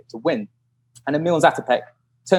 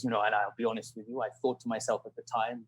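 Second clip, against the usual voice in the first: put-on low, very soft old rival voice; imitated confiding; 4 semitones lower, 14 dB quieter than usual. Old British runner admitting his thought, imitated low and hushed. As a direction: drop to a near-mutter, as if confessing.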